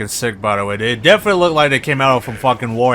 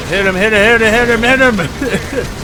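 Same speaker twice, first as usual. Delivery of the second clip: loud, high, chanting hit him; egging on the troops, rising; cheering on a fight; 9 semitones higher, 5 dB louder than usual. Real, not acted. Urging units in battle.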